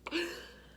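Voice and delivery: high gasp for air. Retold or imitated. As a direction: gasp as if suffocating. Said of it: "So I'm like".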